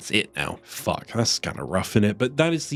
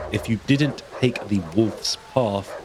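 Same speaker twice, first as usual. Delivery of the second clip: ordinary talk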